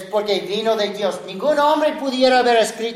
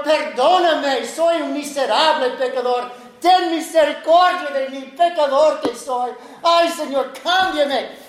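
tearful plea; 5 semitones higher than usual. Retold or imitated. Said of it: "delante del Señor llorando".

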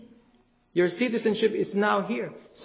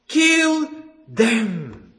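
forceful command; 2 semitones higher, 4 dB louder than usual. Acted. Preacher repeats the command.

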